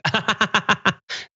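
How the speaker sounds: high-pitched imitated dismissive laugh; mock laugh. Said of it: "She was like".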